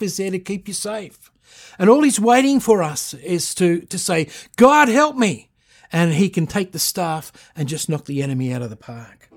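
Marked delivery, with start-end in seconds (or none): 4.5-5.5 high, loud plea to God; desperate cry of "God, help me". Acted. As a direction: call out to God for rescue.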